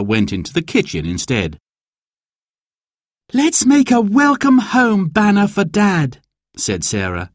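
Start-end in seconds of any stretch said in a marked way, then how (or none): 3.3-6.2 put-on high, eager child's voice. A narrator's reading. Girl suggests a welcome banner, eager and high. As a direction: pitch it up like a keen child.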